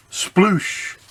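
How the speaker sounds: splash sound effect. Acted.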